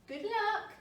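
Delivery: calling out. A narrator's reading. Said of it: "called Mama".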